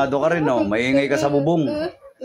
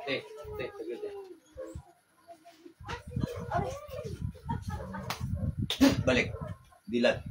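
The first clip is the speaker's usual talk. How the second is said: very soft, brief instructions; hushed coaxing; 13 dB quieter, faster than usual. Real, not acted. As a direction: quietly guide them back to rest. Healer brings the patient round. (mahinang paggabay para kumalma)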